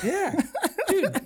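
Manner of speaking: squeaky laughing "Yeah, dude"; laughing high. Real, not acted.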